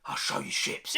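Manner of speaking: whispered menacing promise; mock whisper. Joking that the director boasts to fans, whispering. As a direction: hush it like a playful threat. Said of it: "J J going".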